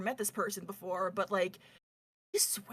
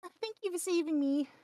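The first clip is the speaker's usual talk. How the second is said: put-on shy rabbit girl; 8 semitones higher than usual.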